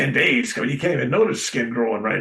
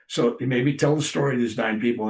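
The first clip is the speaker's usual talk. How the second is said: usual speaking voice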